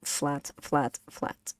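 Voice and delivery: "flat" said level, softening. Shown as a demonstration. Teacher pointing out the flat mark, level tone. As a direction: say "flat" evenly without tune. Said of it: "This is flat".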